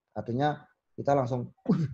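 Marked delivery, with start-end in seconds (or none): 1.7-1.9 sudden high, loud mock gasp of alarm; startled overreaction. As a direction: act out a jumpy overreaction, much higher and louder. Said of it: "gitu, ja-, jangan". (tiruan kaget berlebihan, tinggi dan keras)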